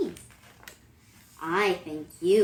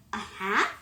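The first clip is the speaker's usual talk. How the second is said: usual speaking voice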